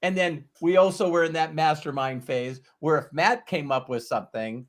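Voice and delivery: ordinary talk, nothing marked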